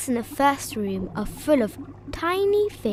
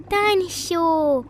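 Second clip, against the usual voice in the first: slow growly dinosaur word, higher and louder; growled dinosaur; 7 semitones higher, 5 dB louder, slower than usual. A narrator's reading. George growls at the seahorse tank like a dinosaur. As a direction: growl it like a little boy playing dinosaur. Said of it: "growls George".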